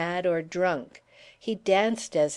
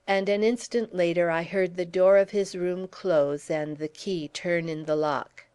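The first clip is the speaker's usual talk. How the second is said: usual speaking voice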